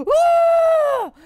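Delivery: long, loud, high "ooh"; frightened wail. Real, not acted. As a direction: wail loudly in drawn-out fright. Startled by monster.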